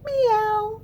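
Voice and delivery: high, short meow; cat meow. Acted. Teacher doing the kids' turn with a high meow. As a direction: squeak it like a kitten. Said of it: "Your turn".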